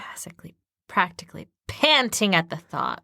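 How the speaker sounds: playful hushed whisper. Real, not acted. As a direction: whisper the punchline. Whispered joke back.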